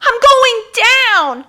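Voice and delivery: high, loud, slow despairing wail; Tanya wailing as she falls; falling wail. Acted. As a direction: wail it as you topple.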